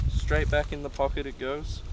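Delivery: ordinary delivery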